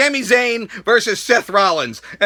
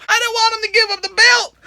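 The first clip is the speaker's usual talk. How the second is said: high, whiny tearful wail; hammed-up sobbing complaint; mock crying; 11 semitones higher than usual. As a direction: wail it like a heartbroken fan. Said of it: "I started crying".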